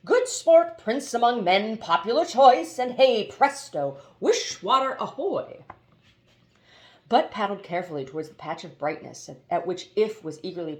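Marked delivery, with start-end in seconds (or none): 0.1-5.7 higher, louder jolly genie; relieved cheer. A narrator's reading. Water genie cheering Haroun's agreement, higher and louder. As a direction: gush with delight.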